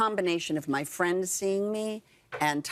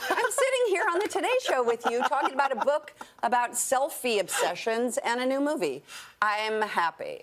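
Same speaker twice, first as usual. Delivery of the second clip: high giddy rush settling into a happy declaration; exuberant joy; 7 semitones higher than usual. Real, not acted. Guest exulting to the host about being on the show, giddy and high. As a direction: start way up high, elated, then ease down.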